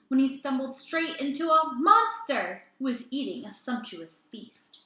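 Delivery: put-on lively read-aloud to children; animated children's story reading; storytime narration. A narrator's reading. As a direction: tell it like a picture book at storytime. Reads picture book aloud.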